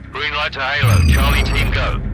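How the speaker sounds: urgent military go-ahead; radio command. Acted. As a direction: call the go order out loud and high. Radio command to launch team drop.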